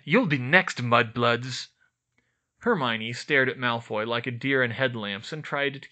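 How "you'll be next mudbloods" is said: louder sneering threat; evil taunt. A narrator's reading. Draco threatens Hermione in the crowd, louder sneering taunt. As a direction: spit the threat at her. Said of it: "grinned evilly".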